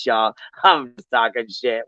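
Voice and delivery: ordinary delivery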